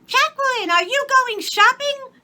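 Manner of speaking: put-on very high, loud child voice; little girl's voice. A narrator's reading. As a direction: squeal like a small girl. Child addresses maid.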